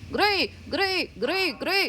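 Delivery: high, loud rapid calls of the name; insistent calls to a pet; repeated loud calls. Real, not acted. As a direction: keep calling until he stirs.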